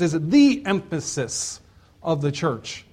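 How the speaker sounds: THE hit hard as "thee"; stress on THE. Real, not acted. Preacher correcting a false assumption to his church, stress on THE. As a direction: punch THE before emphasis. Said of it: "not an emphasis".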